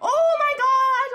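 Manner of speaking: slow, loud, high gasp of joy; shrieking jackpot winner. Real, not acted. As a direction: drag out a stunned oh my gosh. Wins triple red hot.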